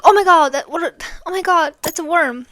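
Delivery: high, loud burst of mock horror; loud, high retold gasp of discovery; retold alarm. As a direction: exclaim in startled amazement, retelling it. Retold shock at seeing a worm.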